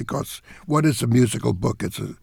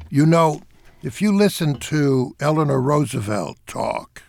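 normal talking voice

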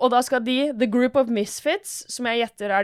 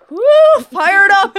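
loud, high drawn-out whoop; fired up; 10 semitones higher, 13 dB louder, much slower than usual. Acted. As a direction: whoop it like a hype crowd. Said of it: "For de er så". (høyt, oppgira jubelrop)

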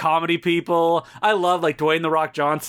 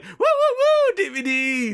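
high squeaky woo imitating his friend; mock Mark cheer; 11 semitones higher than usual. Mocking his cohost's DVD cheer, high squeaky woo. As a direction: ham up his excited cheer. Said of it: "he's like".

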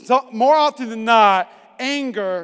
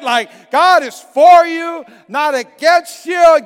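slow, loud and emphatic, higher on every word; rousing shout; 5 semitones higher, 8 dB louder, slower than usual. Real, not acted. Preacher firing up the congregation, slow loud shout. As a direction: drive it home to the crowd.

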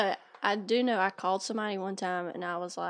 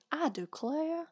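slow mock Southern belle "I declare"; 5 semitones higher, 9 dB quieter, slower than usual. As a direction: drawl it like a Southern lady. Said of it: "I dare say".

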